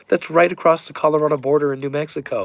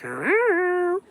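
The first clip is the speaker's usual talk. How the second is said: high squeaky imitated meow; high-pitched mock cat meow. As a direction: mimic your cat meowing. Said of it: "She's like".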